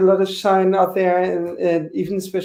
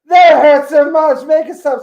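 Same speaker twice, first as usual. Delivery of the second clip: pained yell; 8 semitones higher, 8 dB louder, slower than usual. Retold or imitated. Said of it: "He said".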